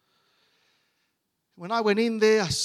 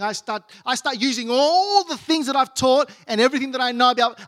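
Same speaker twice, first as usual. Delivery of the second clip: loud, higher, rising preacher's cadence; fervent preaching; 4 semitones higher, 5 dB louder than usual. Real, not acted. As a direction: preach it hard, punching each declaration. Preaches at full force.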